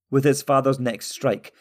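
plain reading voice

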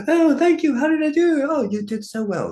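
very high, loud, gushing camp voice. Retold or imitated. Sketch actor off camera, high and gushing camp. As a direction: swoon over the praise in a high camp voice.

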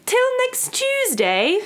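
put-on very high, slow British accent; hammed-up, drawn-out British sign-off; mock British accent. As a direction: trill it high like a pretend Brit. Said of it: "I'm so bad at this".

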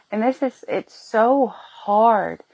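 very slow, choked-up words; emotional. Real, not acted. Mother telling viewers about her pregnancy, choked up and very slow. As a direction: let the emotion slow every word right down. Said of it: "because it's emotional".

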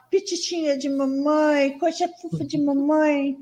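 put-on slow, cooing baby voice; baby talk. Shown as a demonstration. Mother doting on Laurinha, slow baby talk. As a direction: coo drawn-out pet names to a baby. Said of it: "Com a Laurinha eu falo".

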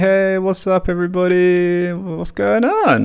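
high, breezy fake-casual hello; play it cool. Greeting police at the door, high fake-casual cheer. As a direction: act totally relaxed and cheery. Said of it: "play it cool mode".

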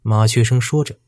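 ordinary delivery

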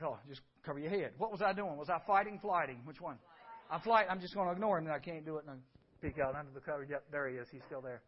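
whispered, low and scared; hushed fear. Retold or imitated. Preacher reliving boyhood fear of a figure in the doorway, hushed. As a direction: tell it like a frightened boy in the dark. Scared boy hiding under covers.

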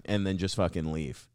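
normal talking voice

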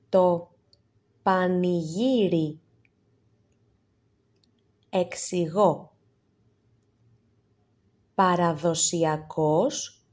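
slow, clear, spaced-out words for learners to repeat; vocabulary drill. Shown as a demonstration. Teacher reads the word list to students, slow and clear. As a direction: give each word alone with a pause after it.

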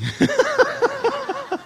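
a short, high nervous giggle; high nervous laugh. Real, not acted. Preacher admitting he was scared, high nervous laugh.

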